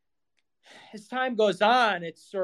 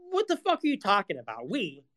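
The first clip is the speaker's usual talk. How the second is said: normal talking voice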